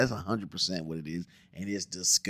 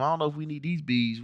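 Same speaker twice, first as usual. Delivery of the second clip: ordinary delivery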